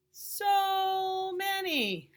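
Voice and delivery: high, slow, drawn-out wonder; amazed. Acted. Child gasping at blueberries, high and slow amazement. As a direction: marvel at the pile of berries. Child marvels again, slower.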